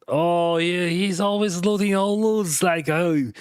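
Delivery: whiny high complaining gamer; a hammed-up annoyed squadmate; mocking complaint. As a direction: mimic a teammate griping about a looter. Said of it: "one guy was complaining about me".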